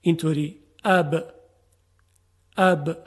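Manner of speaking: "اب" with a short a, then b held closed on the lips; demo of a b. Shown as a demonstration. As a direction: say "اب" crisply, b stopped at the lips.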